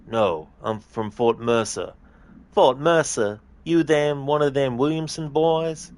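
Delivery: hammed-up cowboy voices, a different twang for each man; terrible Western accents. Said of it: "Excuse the terrible, uh, accents".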